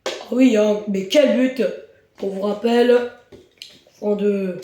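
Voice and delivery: ordinary delivery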